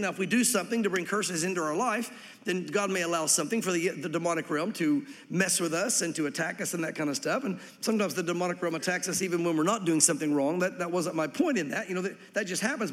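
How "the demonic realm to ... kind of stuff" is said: ordinary delivery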